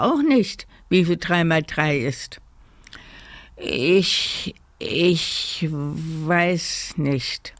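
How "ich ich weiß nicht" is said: very slow, faltering breathy admission; pitiful. A narrator's reading. Kasper confesses he cannot answer, pitiful faltering. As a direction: hesitate and draw it out miserably. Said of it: "sagte er kläglich".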